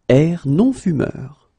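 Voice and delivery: ordinary talk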